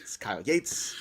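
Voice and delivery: mock English accent. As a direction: show host intro in a put-on English accent. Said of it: "do the whole show in an accent".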